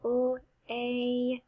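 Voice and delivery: "P, l, a" slowly, sound by sound. Shown as a demonstration. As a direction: hold the long "a". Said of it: "We can sound this one out".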